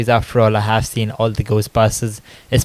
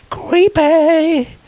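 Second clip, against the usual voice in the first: spooky high voice. Pretend. Comic spooky emphasis on word.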